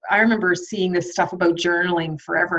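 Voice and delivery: usual speaking voice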